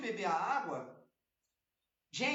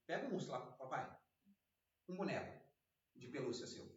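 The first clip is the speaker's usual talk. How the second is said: quiet aside; 8 semitones lower, 8 dB quieter than usual. Real, not acted. Aside to his young son.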